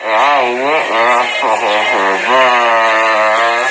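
slow, slurred and low; dragging each word half asleep; groggy from anesthesia. Real, not acted. Post-surgery wisdom teeth, anesthesia.

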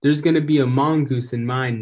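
put-on loud kid's call; yelled. Nelson the loud kid yelling about his drawing. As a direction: yell it out proudly. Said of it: "Nelson yelled".

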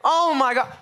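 high, laughing confession; laughing disbelief. Real, not acted. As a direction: laugh through the admission of nearly slipping. Laughing at own near-mistake.

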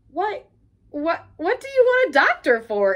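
alarmed. A narrator's reading. Rat alarmed.

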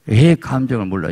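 ordinary talk, nothing marked

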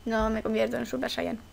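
soft, deflated sulk; disappointed. Real, not acted. Gamer sulks she can't go Super Saiyan, soft and deflated. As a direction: let the air out, let down. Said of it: "Estoy decepcionada".

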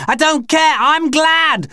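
high, defiant scream; high-pitched hysterical yell. Acted. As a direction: scream it out in hate. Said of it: "Beth was screaming".

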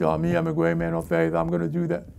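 monotone caricature of a self-satisfied believer; mock boaster. Preacher mocks one who only claims faith, droning boast. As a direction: drone it out as an empty boast.

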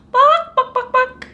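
high clucking bok bok; chicken clucking. Acted. Chicken sound for child.

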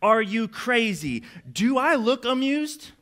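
high, slow, exasperated parent questions; rhetorical scolding. Acted. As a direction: demand it slowly, expecting no answer. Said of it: "these don't require answers".

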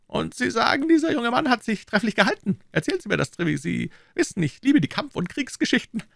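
very high-pitched king's voice; eager king. Acted. King begging Treville for war stories, eager high voice. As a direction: urge the captain on in a high royal voice.

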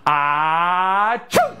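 long rising "ahhh", then a quick "choo"; mock sneeze. Entertainer sneezes along with watching children, big fake sneeze. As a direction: draw out a pretend sneeze. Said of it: "Can you sneeze? Go, achoo".

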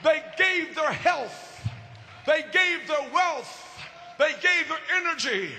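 high, rhythmic pulpit litany; impassioned preaching. Real, not acted. Preacher honoring what the beloved leaders gave, impassioned preaching.

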